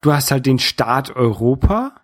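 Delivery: ordinary delivery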